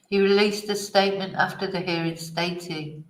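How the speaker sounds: ordinary talk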